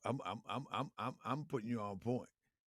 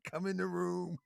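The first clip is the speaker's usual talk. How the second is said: Tariq Nasheed; 8 semitones higher, 7 dB louder than usual. Retold or imitated. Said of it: "as Tariq Nasheed said".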